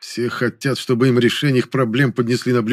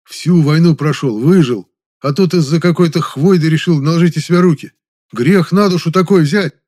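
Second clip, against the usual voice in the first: loud, higher, emphatic scolding; indignant reproach; 7 semitones higher, 7 dB louder than usual. A narrator's reading. Man scolds war-veteran friend over suicide attempt, indignant. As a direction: take him to task, loud and raised.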